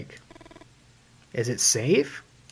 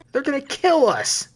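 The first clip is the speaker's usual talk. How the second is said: put-on panicked kid; 10 semitones higher than usual. Kid panics, voiced character.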